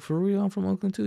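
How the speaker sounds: high, delighted disbelief; excited surprise. Retold or imitated. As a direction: retell your thrilled reaction, high pitched. Excited to share hometown.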